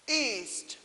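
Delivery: "East." called out loud and high, falling at the end; commanding call. Acted. Mary Poppins calling her direction to the compass, loud.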